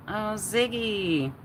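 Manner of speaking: high, slow and sing-song "Oh, Ziggy"; a starstruck gasp at the name, high and drawn out; swooning surprise. Real, not acted. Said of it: "I don't know why I said it like that".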